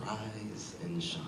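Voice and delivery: soft, slow, drawn-out wake-up greeting; smiling greeting. Acted. Despised man greeting the poet at dawn, soft smiling greeting. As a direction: say it slowly and very softly, with a smile. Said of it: "as he smiles and says".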